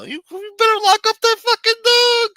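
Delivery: put-on high, loud old-lady shriek; grandmother screaming. Grandmother screaming at the boy chased by a dog. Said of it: "my grandmother was yelling at her".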